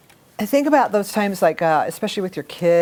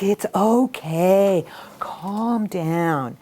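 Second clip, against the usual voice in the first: slow, soothing calm-down tone; much slower than usual. Shown as a demonstration. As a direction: talk them down slowly like a placating clerk. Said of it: "don't we talk a little slower".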